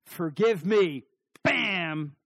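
loud, punched-out plea ending in a bam; sudden forceful declaration; emphatic burst. Shown as a demonstration. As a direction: hit it hard and be done. Demonstrates instant, final forgiveness.

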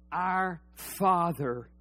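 slow, measured prayer recital; leading the church in prayer, slow and solemn. Shown as a demonstration. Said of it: "Say it with me".

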